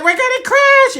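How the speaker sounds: very high, loud alarm; panicked yell. Acted. Yodi diving toward ground, high panicked shriek.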